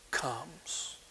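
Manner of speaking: near-whispered final word; soft, voiceless close to a lament; hushed ending. Acted. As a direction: drop suddenly to a whisper. Hushed last word of read lament.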